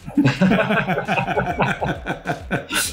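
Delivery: long, high laughing fit; real laughter. Real, not acted. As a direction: keep laughing after the film quote. Laughs after Almost Famous quote.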